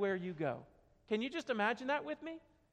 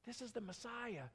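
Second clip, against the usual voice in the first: hushed repeat; 12 dB quieter than usual. Shown as a demonstration. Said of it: "slow learners".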